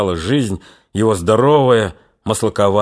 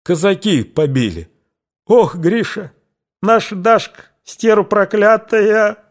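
higher, slow, tearful and outraged; indignant tears; 10 semitones higher than usual. A narrator's reading. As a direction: cry out in angry tears. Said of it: "послышались негодующие слёзы".

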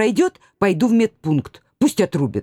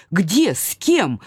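ordinary delivery, nothing marked

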